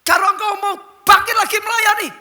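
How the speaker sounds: high, loud booming challenge; God's commanding voice. Acted. God challenging the retired pastor, loud high booming command. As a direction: thunder it down as God speaking to his servant. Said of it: "kata Tuhan".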